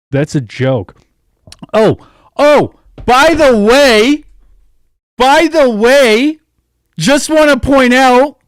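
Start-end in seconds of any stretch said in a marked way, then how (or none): 1.7-8.4 much higher, louder and slow, gloating; mock sing-song announcement. Real, not acted. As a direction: sing it out like a smug town crier.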